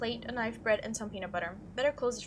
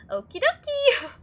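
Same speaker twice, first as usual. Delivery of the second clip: very high, loud, drawn-out reply; chirpy note voice; 14 semitones higher, 9 dB louder, slower than usual. Acted. Reading the second writer's reply on the fridge note, chirpy high voice. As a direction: squeak it out slowly as another person.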